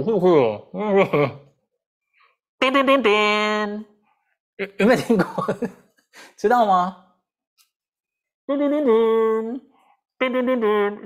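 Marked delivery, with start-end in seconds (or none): 2.6-3.9 slow, higher "叮，叮，叮，叮" in a sing-song tune; intonation demo. Shown as a demonstration. Teacher sings the bare intonation for students. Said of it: "我弄语调给你看啊". 8.5-11.1 intonation demo. Shown as a demonstration. Teacher sings the bare intonation for students. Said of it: "我弄语调给你看啊".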